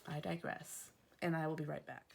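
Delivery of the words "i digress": ordinary talk, nothing marked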